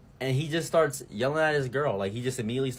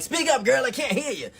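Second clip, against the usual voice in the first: mock call to speak up; 11 semitones higher, 3 dB louder than usual. Telling quiet girl to speak up.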